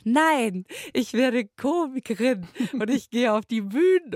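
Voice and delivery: put-on imagined child telling the parents she'll be a comedian, defiant; defiant grown child. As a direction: blurt it out like a stubborn kid. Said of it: "und dann sagt".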